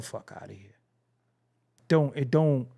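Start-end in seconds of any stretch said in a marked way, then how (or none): 0.0-0.7 whispered brush-off, breathy and low; a whispered get out of here; dismissive whisper. Real, not acted. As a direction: hiss it out under your breath. Dismissive aside.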